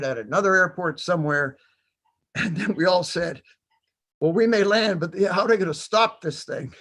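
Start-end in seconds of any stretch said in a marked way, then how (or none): 4.2-6.7 higher and laughing through the question; a chuckling, disbelieving joke. Real, not acted. Joking about the plane landing.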